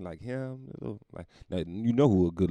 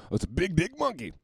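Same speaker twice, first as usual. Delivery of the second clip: ordinary delivery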